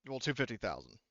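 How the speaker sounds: ordinary talk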